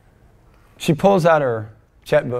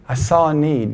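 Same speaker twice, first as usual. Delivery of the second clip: usual speaking voice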